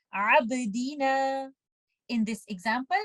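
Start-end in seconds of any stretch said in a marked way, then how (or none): 0.1-1.5 abdina recited slowly with a light ba bounce; small qalqala. Shown as a demonstration. Teacher recites abdina for students, slowly. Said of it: "recite it like this".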